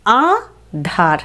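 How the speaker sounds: আধার drawn out slowly, nasal আ; ধীরে আধার, নাসিক্য আ. Shown as a demonstration. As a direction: stretch আধার, nasal first vowel. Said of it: "আয়ের উচ্চারণ নাসিক্য".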